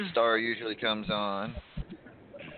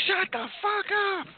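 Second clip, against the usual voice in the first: feminine voice. Retold or imitated. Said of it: "feminine voice tell us".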